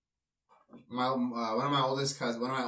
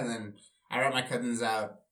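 ordinary talk, nothing marked

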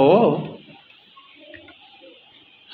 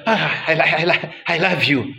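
mock stammering; 3 semitones lower, 3 dB quieter than usual. Mocking stammering husband.

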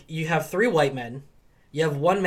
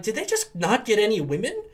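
rising incredulous squeal; high, loud disbelieving question; shocked disbelief; 10 semitones higher, 5 dB louder than usual. Retold or imitated. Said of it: "And I was like".

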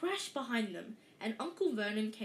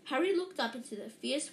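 ordinary delivery, nothing marked

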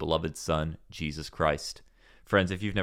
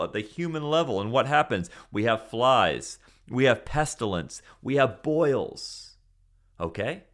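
fervent preaching; 5 semitones higher than usual. Acted. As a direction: drive each plague home to the congregation. Preaching plagues at full force.

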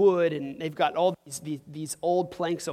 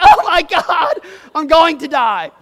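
much higher and much louder, half laughing in hammed-up terror; mock panic; 9 semitones higher, 14 dB louder than usual. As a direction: squeal it like a kid sure he's dying, laughing through it. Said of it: "And you're like".

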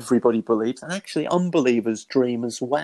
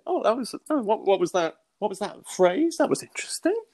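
much higher, intrigued and startled; surprised; 9 semitones higher than usual. Retold or imitated. Hearing a sudden phrase in his mind, intrigued surprise. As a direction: catch yourself noticing something odd.

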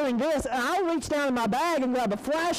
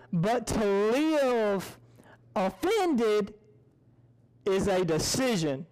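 very slow, pausing between phrases; slow and deliberate; 4 semitones lower, slower than usual. Shown as a demonstration. Speaker drives the lesson home to listeners. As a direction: spell out the moral slowly.

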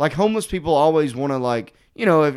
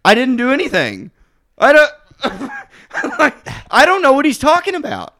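high, loud, laughing protest; protesting innocence; 11 semitones higher, 4 dB louder than usual. Real, not acted. Comic denies snitching to host, high-pitched protest.

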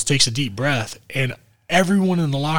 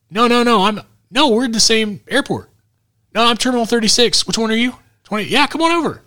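much higher, excited put-on voice; prank caller voice; 11 semitones higher, 4 dB louder than usual. Prank-calling a friend, pretending to be at the airport, high put-on voice. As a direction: pitch it way up to fool your buddy on the phone. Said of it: "I wanna prank him".

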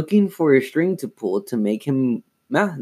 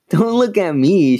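high, loud teasing; 8 semitones higher, 6 dB louder than usual. A narrator's reading. Lindsay shrugs off her sister, teasing. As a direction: tease with a lifted, louder voice. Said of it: "she teased".

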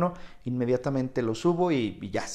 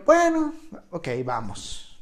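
own quoted voice; 2 semitones higher, slower than usual. Retold or imitated. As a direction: shrug and agree. Quoting own reply.

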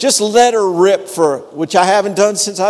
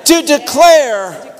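slow, higher, shouted proclamation; preaching at full force; 4 semitones higher, slower than usual. Real, not acted. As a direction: build the praise line by line like a sermon peak. Preaching the psalm.

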